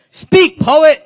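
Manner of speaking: high, loud shout of encouragement; yelled cheer. Shown as a demonstration. Teaching students how to cheer, loud yell. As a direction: call it out like a fan in the crowd. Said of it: "You could yell stuff like".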